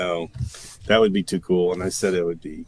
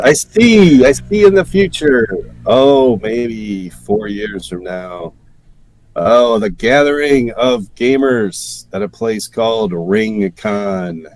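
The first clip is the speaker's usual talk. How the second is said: mystic seer voice; 3 semitones higher, 10 dB louder, slower than usual. Acted. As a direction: proclaim it like a carnival psychic. Mock psychic seeing the future.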